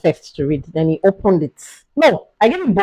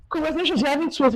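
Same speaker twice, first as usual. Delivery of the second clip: higher, softer congratulating voice; cheerful doctor; 7 semitones higher, 9 dB quieter, faster than usual. Retold or imitated. Replaying the doctor's words as she lay on the bed, higher and soft. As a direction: lilt the good news gently. Doctor's announcement retold again.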